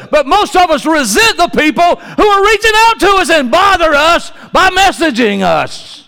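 high, loud preacher's cry, slowed on the body of Christ; preaching at full force. Acted. Preacher tells the church there's no solo faith, full-force preaching. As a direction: lift it up and drive it home to the congregation.

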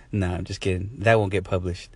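normal talking voice

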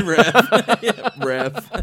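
high, loud laughing cry of ref; laughing mock call. Retelling Cormier's entrance, laughing loud ref. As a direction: shout it while cracking up. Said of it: "He said".

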